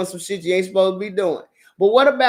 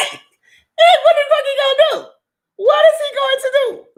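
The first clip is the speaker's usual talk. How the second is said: much higher and louder, laughing; laughing shout; 5 dB louder than usual. Real, not acted. Daring viewers to guess the man's reaction, laughing shout. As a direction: fire it off, cracking up.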